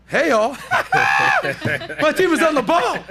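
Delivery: real laughter while praising the team; laughing. Real, not acted. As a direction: burst out laughing mid-hello. Switching cameras, cracking up.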